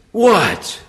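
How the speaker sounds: put-on a teen caught out, feigning innocence; guilty kid attitude. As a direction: play dumb like a caught kid. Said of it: "he had an attitude".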